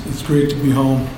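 ordinary talk, nothing marked

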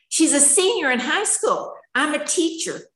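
high-pitched indignant plea; exasperated protest. Retold or imitated. Mother protesting that her daughter must graduate, exasperated.

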